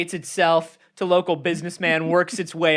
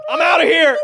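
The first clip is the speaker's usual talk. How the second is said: gleeful escape; 11 semitones higher, 9 dB louder than usual. Acted. Squid escapes the scene.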